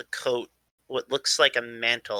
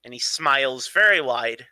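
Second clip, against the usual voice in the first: usual speaking voice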